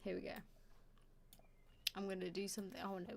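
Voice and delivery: normal talking voice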